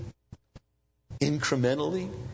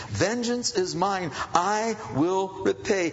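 slow, higher solemn scripture; God's voice; 8 semitones higher, slower than usual. Acted. Pastor quoting God, slow and solemn. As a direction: pronounce it with weight. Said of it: "You, you take care of it".

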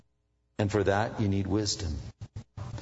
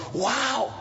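high amazed wow; surprised wow; 12 semitones higher than usual. Retold or imitated. Pastor reacting to an angry client, surprised. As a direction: act stunned. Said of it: "I'm going".

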